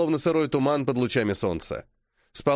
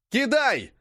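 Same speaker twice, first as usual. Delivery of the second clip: sharp, high command yell; shout; 9 semitones higher than usual. A narrator's reading. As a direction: bark the throw order. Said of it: "кричу я".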